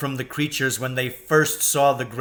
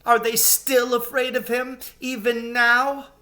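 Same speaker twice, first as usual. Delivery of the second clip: tearful; 11 semitones higher than usual. A narrator's reading. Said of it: "sobbed Susan".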